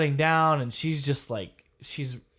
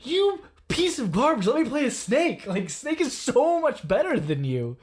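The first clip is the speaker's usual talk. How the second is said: frustrated gamer rant; 11 semitones higher, 4 dB louder than usual. Retold or imitated. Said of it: "And he's just like".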